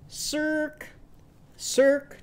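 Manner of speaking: high-pitched seal bark on one note; seal call. Shown as a demonstration. Teacher modelling a seal's call for children, high seal call. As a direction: give two high seal calls, held level. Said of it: "What would Daisy Dragon seal say?".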